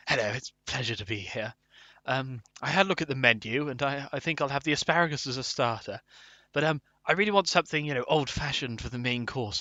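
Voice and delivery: low, hushed voice of a polite Savoy diner. Acted. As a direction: order discreetly at a grand hotel. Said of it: "trying to go to the Savoy".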